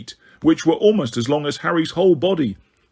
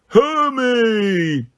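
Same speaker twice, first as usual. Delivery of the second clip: drawn-out giant call, higher than narration; deep rumbling voice; 8 semitones higher than usual. A narrator's reading. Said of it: "in a deep, rumbling voice".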